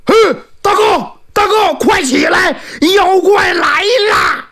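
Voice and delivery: high, frantic cry to wake his brother; startled younger brother shouting a warning, panicked. Acted. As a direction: yell in alarm that the monster is here. Brother wakes elder with alarm.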